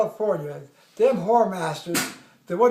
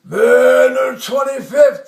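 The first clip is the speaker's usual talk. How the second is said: loud, drawn-out military address; bullhorn announcement; 8 dB louder, much slower than usual. Retold or imitated. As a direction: imitate a colonel hailing troops by bullhorn. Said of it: "the big bullhorn".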